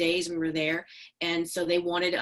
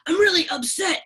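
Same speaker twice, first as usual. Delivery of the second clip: gruff upset man; 10 semitones higher, 7 dB louder than usual. Retold or imitated. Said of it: "He, he's like".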